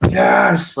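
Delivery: high, loud burst of excitement; triumphant yes, retold. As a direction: let the last energy out in one re-enacted victory cry. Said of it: "excitement out of my system to say".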